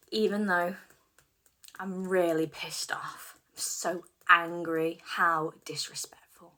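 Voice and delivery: slow, emphatic affirmations to repeat; guiding a tapping round with heavy emphasis; tapping script. Acted. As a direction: lead the tapping phrases, slow and firm. Leads EFT tapping script.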